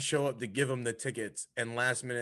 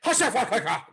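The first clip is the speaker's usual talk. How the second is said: high, loud wrestler's war cry; yelled catchphrase; 11 semitones higher, 8 dB louder, much slower than usual. Retold or imitated. Imitating how Beastman yells at them, high full yell. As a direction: bellow it like Beastman charging at people. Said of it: "He just yells at them".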